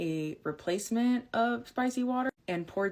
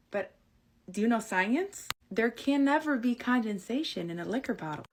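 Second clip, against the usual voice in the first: ordinary delivery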